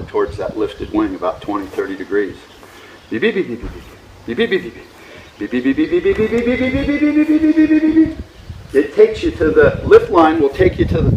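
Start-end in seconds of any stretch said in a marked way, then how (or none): 3.1-5.6 short soft bursts of rapid beeps; imitated variometer beeps. Shown as a demonstration. Imitates glider variometer beeping. 5.6-8.2 rapid beeps climbing steadily to very high pitch; rising variometer beeps. Shown as a demonstration. Imitates variometer climbing in lift.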